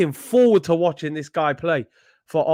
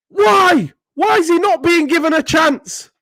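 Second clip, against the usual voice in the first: high, loud cry of disbelief; outraged shout; 9 semitones higher, 3 dB louder than usual. Real, not acted. Fan shouting at the news of the loan, outraged. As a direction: yell why at the screen.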